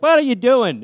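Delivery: loud, high acted question; startled protest. Annoyed owner to the cat.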